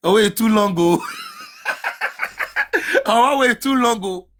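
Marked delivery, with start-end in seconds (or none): 1.1-3.0 a very high, shrieking burst of laughter. Real, not acted. Vlogger shrieks with laughter at a long trailer.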